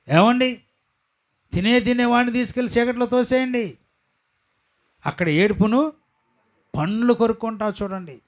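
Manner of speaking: high, loud, emphatic pulpit voice; full-force preaching. Acted. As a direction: drive each phrase home.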